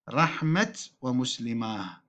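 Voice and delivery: "رحمة" with final t, "ومسلماه" with final h; reversed endings. Shown as a demonstration. Teacher showing reversed dialect, "رحمة" on t, "ومسلماه" on h. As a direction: say rahmat, then muslimah with long aa. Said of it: "عكس هذه اللغة الأفصح".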